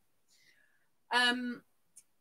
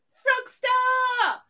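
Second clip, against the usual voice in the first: high, loud name called out; excited shout; 11 semitones higher, 9 dB louder than usual. Real, not acted. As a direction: shout a happy welcome. Greeting viewers in chat.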